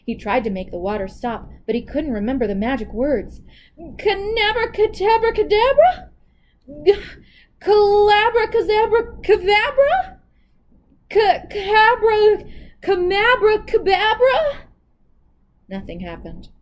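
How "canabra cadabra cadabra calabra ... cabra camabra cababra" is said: much higher, louder frantic tries; panicked guessing. Acted. Anansi floundering in the river, frantic panicked guesses. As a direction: scramble for the words in panic. Anansi forgets the magic words.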